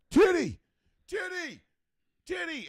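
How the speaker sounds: high, loud excited shouting; excited yell. Retold or imitated. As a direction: yell it like a kid spotting something on TV. Yelling at TV in retold story.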